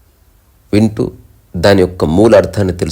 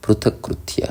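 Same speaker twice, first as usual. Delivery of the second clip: softly whispered Sanskrit word; hushed whisper of the verse word; 3 semitones higher, 7 dB quieter than usual. Acted. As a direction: breathe పృథక్కృత్య out in a whisper.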